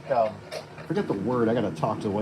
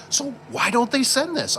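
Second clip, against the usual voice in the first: high, puzzled questioning voice as Caleb; quoting Caleb; 11 semitones higher than usual. Retold or imitated. Caleb asking why the parts weren't sent, high puzzled voice. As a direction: raise the pitch to play Caleb baffled.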